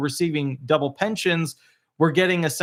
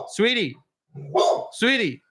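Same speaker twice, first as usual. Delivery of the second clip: higher, drawn-out call; calling the dog; 5 semitones higher than usual. Real, not acted. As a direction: coax the nervous dog gently.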